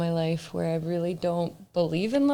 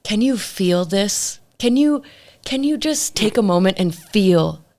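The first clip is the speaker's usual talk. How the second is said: slow creepy pleading; slower than usual. Retold or imitated. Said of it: "asking me questions like".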